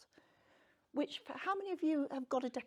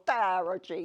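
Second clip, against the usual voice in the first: attempted Italian; 3 semitones lower, 11 dB louder than usual. Shown as a demonstration. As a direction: say "tarocchi" as "TA-ro-jee" in a hammed-up Italian accent. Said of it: "figure out what they call".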